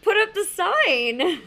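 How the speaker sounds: high, loud, drawn-out mock plea; exasperated plea. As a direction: stretch it out as a laughing, exasperated order. Imagined exasperated plea to worker.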